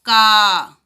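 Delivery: का loud with a long aa. Shown as a demonstration. Teacher to children, repeating का with a long aa. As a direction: say का with a clear long aa. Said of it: "आप सुनिए".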